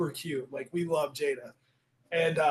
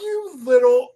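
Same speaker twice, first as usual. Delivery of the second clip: loud, higher, teasing outburst; mock indignation; 9 semitones higher, 12 dB louder, slower than usual. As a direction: burst out in playful outrage at your son. Mock outrage at son taking credit.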